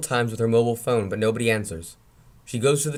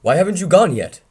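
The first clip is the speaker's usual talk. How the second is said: annoyed call; 7 semitones higher, 7 dB louder than usual. Acted. Uncle sees her still there.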